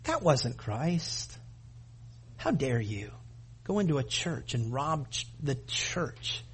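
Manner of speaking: low, hushed rebuke; quiet retort. Retold or imitated. Preacher retelling his hushed rebuke of sect members. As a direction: mutter it softly, stunned. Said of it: "and I go".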